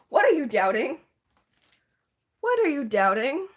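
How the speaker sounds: ordinary talk, nothing marked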